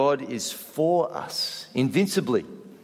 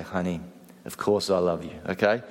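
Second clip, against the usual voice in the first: low, soft, loving husband; tender reassurance; 8 semitones lower, 3 dB quieter than usual. Shown as a demonstration. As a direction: drop the voice low and soft, sincerely. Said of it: "look her deeply in the eyes and you say".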